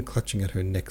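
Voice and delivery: ordinary delivery, nothing marked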